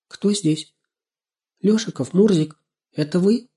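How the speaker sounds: put-on high, questioning girl's voice. A narrator's reading. Girl hearing a rustle asks again, insistently. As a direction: press for an answer from the unseen. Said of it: "уже настойчивее задала вопрос".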